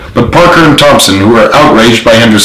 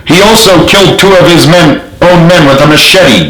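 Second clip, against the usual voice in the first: higher, shouted accusation; yelled; 7 semitones higher than usual. A narrator's reading. Soldier yelling out the general's crime, higher. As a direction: call it out from the crowd. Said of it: "yelled a random soldier".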